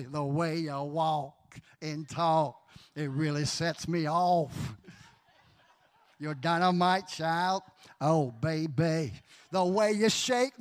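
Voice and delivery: slow, low crooned come-on; smooth lover. Retold or imitated. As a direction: lay it on thick like a soul singer. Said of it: "But that's how I felt".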